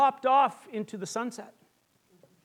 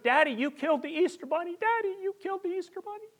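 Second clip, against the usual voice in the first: mock children wailing at their father; kids crying out; 10 semitones higher, faster than usual. Kids wailing at daddy after the crash, high child's cry. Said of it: "crying out".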